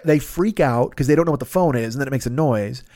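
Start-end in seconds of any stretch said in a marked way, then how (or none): none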